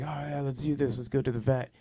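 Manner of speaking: mildly imitating a happy friend; happy, eager. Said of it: "They're all happy".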